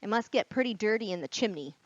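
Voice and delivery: plain reading voice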